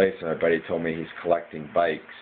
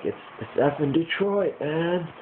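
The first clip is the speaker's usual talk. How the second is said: half-hearted yell, pitched way up; mild mock yell; 8 semitones higher than usual. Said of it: "But I was yelling at".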